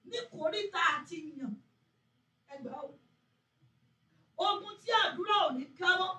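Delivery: loud chanting prayer, near shouting; fervent prayer. Acted. Pastor leading YouTube mothers in prayer, loud fervent chanting. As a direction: cry out the prayer and urge them on.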